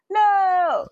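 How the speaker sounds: dramatic cry of grief. Retold or imitated. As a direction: wail it like a TV tragedy. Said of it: "And he was like".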